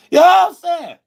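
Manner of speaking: squeaky high shouted tag; high yelp. Real, not acted. Streamer yelps his catchphrase at chat in a high voice. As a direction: toss it off in a sudden high yelp.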